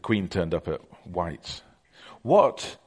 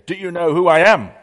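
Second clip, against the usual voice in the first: put-on loud shout into an old ear, higher; 9 semitones higher, 12 dB louder than usual. Reagan shouting at an old lady, loud and high. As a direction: bellow it at a deaf old lady. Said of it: "he shouted in her ear".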